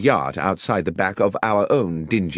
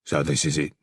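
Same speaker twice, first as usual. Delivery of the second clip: lightly voiced gruff villain; a touch of a low, gruff crook; 5 semitones lower than usual. A narrator's reading. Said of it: "a gruff voice said".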